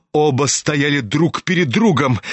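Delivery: usual speaking voice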